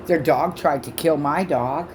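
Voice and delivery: ordinary talk, nothing marked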